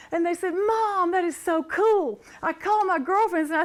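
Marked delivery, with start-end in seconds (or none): 0.5-2.2 high, loud mock amazement; imitated excited sons. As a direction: play the impressed kid cheering on mom. Voices her sons' excited reply.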